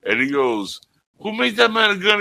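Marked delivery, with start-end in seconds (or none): 1.2-2.2 high mock angry officer. Quoting Dark Helmet.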